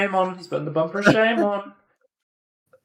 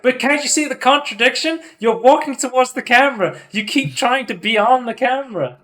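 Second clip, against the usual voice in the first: smug needling; 8 semitones higher than usual. Retold or imitated. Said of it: "He's like".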